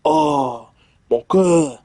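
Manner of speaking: hammed-up French accent in a changed voice; letter read in a mock French accent; Frenchy accent. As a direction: do your worst French accent. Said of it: "I tried doing Frenchy".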